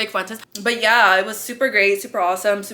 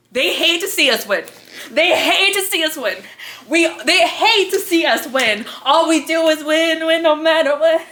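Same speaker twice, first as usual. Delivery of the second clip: triumphant boasting; 6 semitones higher than usual. Retold or imitated. Mock victory boast quoted from friends.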